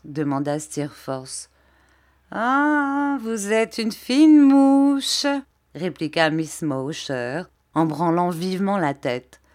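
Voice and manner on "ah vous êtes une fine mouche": high, loud, stretched-out retort; teasing exclamation. A narrator's reading. Little woman answering Steerforth's shrewd question, high teasing exclamation. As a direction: swell into a knowing, playful cry.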